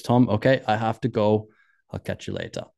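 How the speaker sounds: badly imitated Austrian accent. Skier says goodbye to his friend, bad Austrian accent. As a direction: have a clumsy go at an Austrian accent. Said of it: "That's a really bad Austrian accent".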